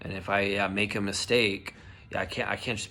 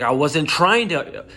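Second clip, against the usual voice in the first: loud, high angry protest; getting mad; 7 semitones higher, 11 dB louder, slower than usual. Retold or imitated. Worker defending himself to HR, angry and loud. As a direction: burst out in indignation. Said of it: "then I started getting mad".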